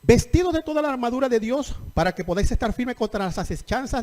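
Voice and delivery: lectura fuerte y proclamada de la Biblia; lectura bíblica predicada. Acted. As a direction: proclama el versículo ante la congregación. Lee las escrituras con fuerza. (lectura de predicador, enérgica y proclamada)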